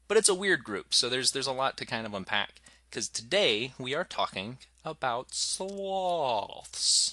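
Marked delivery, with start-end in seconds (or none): 2.9-7.1 slow drawn-out sloth voice; super slow sleepy sloth. Pretend. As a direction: stretch every word like a sleepy sloth. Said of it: "You have to say it like a sloth".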